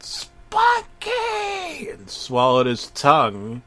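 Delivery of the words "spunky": high, squeaky cartoon yell of Spunky. Dying Rocco shrieking for his dog. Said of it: "while he yelled".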